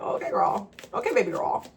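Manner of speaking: playfully facetious pet-name address; tongue-in-cheek, joking address. Shown as a demonstration. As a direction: tease with mock-sassy endearments. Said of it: "like, facetiously".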